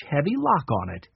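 plain reading voice

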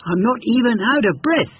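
high and smug; boastful hare voice. Acted. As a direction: show off, barely trying. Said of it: "he shouted".

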